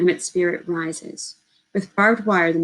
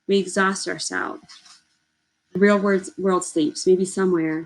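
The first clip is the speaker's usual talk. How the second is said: ordinary delivery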